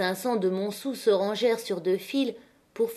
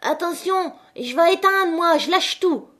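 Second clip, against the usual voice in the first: very high, loud piping call; shrill boy's voice; 9 semitones higher, 5 dB louder than usual. A narrator's reading. Jeanlin about to release the steam, shrill shout. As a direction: shout a warning in a shrill child's voice. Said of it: "la voix aiguë de Jeanlin".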